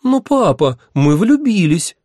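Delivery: put-on very high, loud girlish whine; high-pitched lovestruck chirp; chirping girls. A narrator's reading. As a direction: plead with papa, twittering. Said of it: "хором защебетали эти изумительные барышни".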